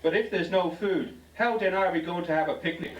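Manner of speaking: half-hearted wail of protest; crying out. Acted. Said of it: "cried Anthony".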